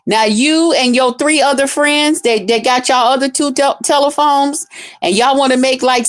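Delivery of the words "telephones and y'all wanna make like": normal talking voice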